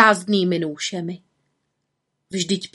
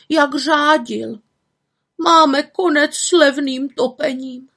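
put-on upset old women; 7 semitones higher than usual. Grannies lamenting to the witch about the new forester. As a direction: complain bitterly as old women.

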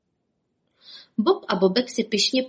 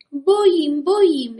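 put-on high, loud crying child; scared wail; 7 semitones higher, 5 dB louder, slower than usual. A narrator's reading. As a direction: wail in fear like a chick. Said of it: "ječel". (vyděšené kvílení)

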